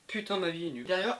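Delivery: ordinary delivery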